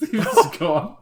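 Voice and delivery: laughing through the words, high and slow; giggling it out. Real, not acted. Friend laughing while retelling the vanished director.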